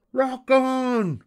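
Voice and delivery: very high, loud pirate cheer; crew cheer. A narrator's reading. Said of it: "cheered the crew".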